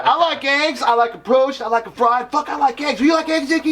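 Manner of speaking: high, manic rambling about eggs; babbling fan. Retold or imitated. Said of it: "You're like".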